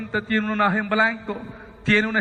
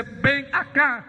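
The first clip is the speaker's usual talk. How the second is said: voz de autoridad; 3 semitones higher, slower than usual. Retold or imitated. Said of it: "con una autoridad mayor".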